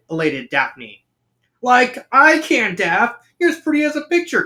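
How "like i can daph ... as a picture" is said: high-pitched goofy cartoon slacker; Shaggy. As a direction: pay a compliment in a raised cartoon pal voice. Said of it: "compliments Shaggy".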